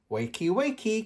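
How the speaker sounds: put-on high, bright child's wake-up call; a little girl waking her parents; cheerful little Peppa. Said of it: "cries Peppa".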